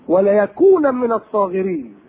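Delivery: slow drawn-out verse; Quranic recitation. Acted. Preacher recites the wife of al-Aziz's threat, slowly chanted.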